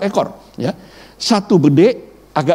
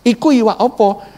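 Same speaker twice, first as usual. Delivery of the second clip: Javanese speech; 7 semitones higher than usual. Retold or imitated. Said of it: "dia bahasa Jawa".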